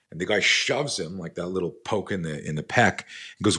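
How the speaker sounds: ordinary talk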